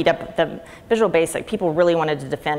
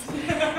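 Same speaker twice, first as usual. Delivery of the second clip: sudden high giggle; high burst of laughter; 11 semitones higher, 5 dB quieter than usual. Real, not acted. Telling the host about her dad, high giggle. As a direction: let a bright laugh slip out.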